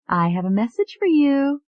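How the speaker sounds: high, eager secretary; lightly voiced young woman. A narrator's reading.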